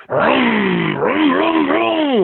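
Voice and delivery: high revving engine noise, climbing then holding way up; engine vroom. Retold or imitated. Imitating the car revving while running out of gas, very high engine noise. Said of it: "You're like".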